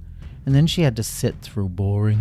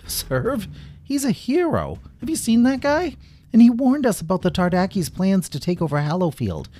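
much higher, mocking incredulous voice; scoffing guard; 11 semitones higher than usual. A narrator's reading. Guard scoffing at Lila's warning about Serv.

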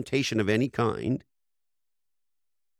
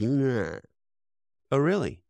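mock surprise, pitched up; sarcastic doubt; 3 semitones higher, much slower than usual. Speaker to a podcast host, mocking that cliché with a sarcastic "really?". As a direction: needle the old cliché with a raised "really?". Mocking the idea people hate change.

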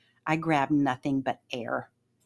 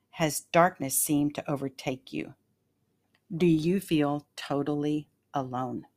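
normal talking voice

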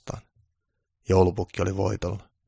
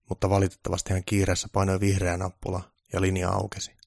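usual speaking voice

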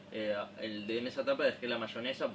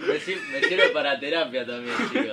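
laughing; 5 semitones higher, 10 dB louder than usual. Real, not acted. Laughing through story.

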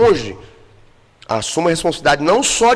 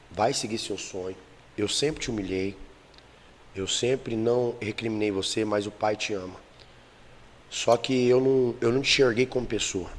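quiet retold father; 7 semitones lower, 10 dB quieter than usual. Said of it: "o pai dele virou e falou assim".

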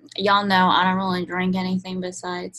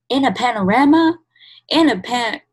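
loud, higher jokey TikTok voice; mock TikTok joke; 5 semitones higher, 8 dB louder than usual. Mimicking people on TikTok, hammed-up jokey voice. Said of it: "people try to be funny, and they're like".

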